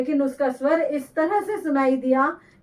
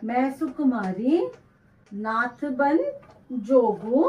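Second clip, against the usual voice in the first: slow verse read in phrases with long pauses; dictation reading. Acted. Teacher dictating the verse to students, slow and phrase by phrase. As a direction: read each phrase slowly so students can write it down.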